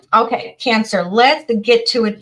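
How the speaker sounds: rally the crowd to start the reading; loud rallying call. Real, not acted. Tarot reader calling her Cancer viewers to start, loud and rallying.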